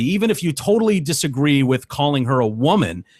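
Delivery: normal talking voice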